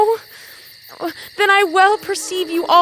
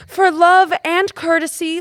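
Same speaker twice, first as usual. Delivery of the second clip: ordinary talk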